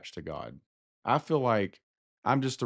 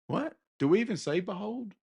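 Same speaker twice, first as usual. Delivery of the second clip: incredulous; 9 semitones higher than usual. Retold or imitated. Incredulous reaction voiced.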